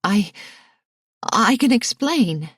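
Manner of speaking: nervous stammered excuse; stammering. A narrator's reading. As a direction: caught out and groping for words. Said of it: "she stammered".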